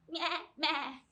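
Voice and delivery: mock goat bleating. Librarian shows the children the goat sound, bleating. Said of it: "it makes a sound like this".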